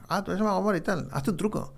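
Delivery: high, mocking pushy-fan voice. A stranger teasing the magician to do a trick, mock pushy voice. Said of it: "y me decía la gente".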